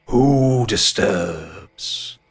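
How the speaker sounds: booming ancient tree. Acted. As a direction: boom it out like an ancient oak. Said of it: "feel the booming bass in your chest".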